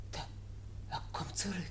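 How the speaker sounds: soft unvoiced warning whisper; urgent whisper. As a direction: hiss the warning quietly. Sees him coming back. (erschrocken geflüstert)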